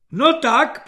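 loud, high urging; impatient prompting. A narrator's reading. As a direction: prod him for an answer. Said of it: "pobízel ho netrpělivý generál". (netrpělivé pobízení)

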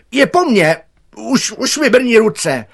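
high, very loud wail; desperate cry. A narrator's reading. Gardener's hands go numb in the tree, desperate shouting. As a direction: cry out as if about to fall. Gardener losing grip cries out.

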